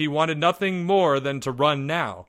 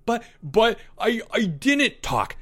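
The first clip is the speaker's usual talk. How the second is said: high, halting, flustered protest; panicked stammer; 11 semitones higher than usual. A narrator's reading. Albert is cornered by Leo, stammering in panic. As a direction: stumble over the words, pitched up.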